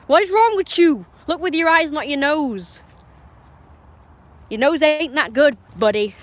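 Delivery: high, loud scolding of the dog; exasperated scolding. Real, not acted. Scolding the dog for using its nose, high loud scolding. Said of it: "that stupid dog, you know, pissed me off".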